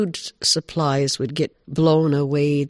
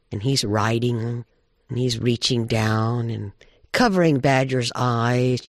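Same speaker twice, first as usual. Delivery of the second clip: ordinary delivery, nothing marked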